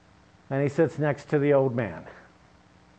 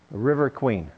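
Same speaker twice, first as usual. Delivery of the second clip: usual speaking voice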